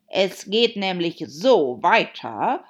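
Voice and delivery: loud, slow Hatter voice; big, drawn-out storybook eccentric; Mad Hatter. A narrator's reading. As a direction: declaim it slowly and loudly.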